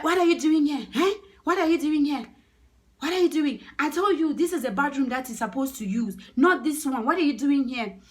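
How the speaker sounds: acted furious shout, high and loud; raging, scolding confrontation; angry telling-off. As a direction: demand an explanation at full volume. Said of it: "was so mad".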